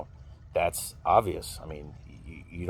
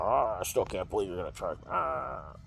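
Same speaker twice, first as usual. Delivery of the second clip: hemming and hawing; 6 dB quieter than usual. Retold or imitated. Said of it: "he's hemming and hawing".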